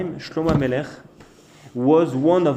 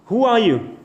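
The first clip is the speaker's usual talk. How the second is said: higher, louder challenge; indignant challenge; 7 semitones higher, 5 dB louder than usual. Acted. King Solomon confronting the boastful bird, indignant. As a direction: confront them like an affronted king.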